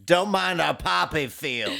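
high, slow mock old woman; old Ozark woman. Old woman greeting guests at her house, slow hammed-up welcome. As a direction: play the backwoods old woman, loudly. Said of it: "She's the old woman from Ozark".

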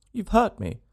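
put-on high little girl's voice, reproachful; a small child complaining she was hurt; lightly reproachful young girl. A narrator's reading. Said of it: "said a childish voice reproachfully".

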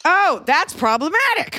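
high, slow, theatrical gasp; mock outrage. Mocking the catchphrase.

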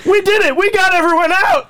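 high, jubilant acted cheer; excited cheer. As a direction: celebrate like the rescue just worked. Actor celebrates rescue in skit.

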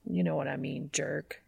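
whispered affectionate mutter. A narrator's reading. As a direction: mutter it under your breath. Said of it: "Dean mutters".

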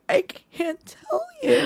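high, slow, wobbly imitation of a crying friend; mock crying. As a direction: imitate her tearful refusal, hammed up. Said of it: "she was crying suddenly".

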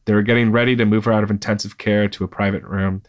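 ordinary delivery, nothing marked

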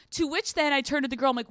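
ordinary talk, nothing marked

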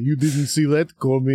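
lightly put-on catchphrase voice; mock catchphrase. Podcaster mocking a repeated movie line, mock catchphrase. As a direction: imitate a movie hero's smug line, lightly. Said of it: "I hate the".

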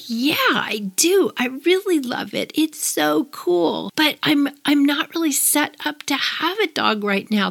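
lightly voiced best man letting her down gently; polite best man. Best man on the phone, gently hedging about the surprise puppy. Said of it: "It's like".

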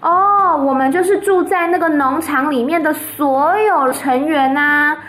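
put-on high, bright-eyed little girl voice; little Fern chattering to her parents, high and eager. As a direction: tell it like an eager child reporting news. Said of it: "然后芬就说".

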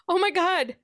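high-pitched startled exclamation; shocked blurt. Retold or imitated. Heroine bursting into the locker room, high shocked blurt. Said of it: "the first thing out of her mouth is".

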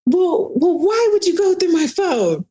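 high, strained mock-whine of the accused man; whiny defensive boyfriend. Caught boyfriend whining at his girlfriend for checking his phone, high mock whine. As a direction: play him caught out and squealing back. Boyfriend's retort voiced in story.

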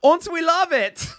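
high mock German accent; a cheering German officer, hammed up. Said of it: "I can't do a German accent".

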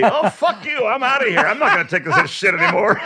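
high, indignant mock outburst; imitated screaming. Woman quitting on the dentist who shouted, high imitated fury. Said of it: "And then I hear shouting, screaming in back".

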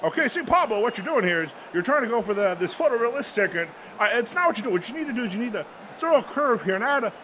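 John Madden. Acted. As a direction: explain it like a TV football analyst drawing plays. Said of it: "I'm just gonna go for the John Madden".

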